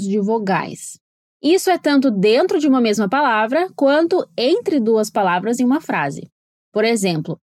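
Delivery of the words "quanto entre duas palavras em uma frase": normal talking voice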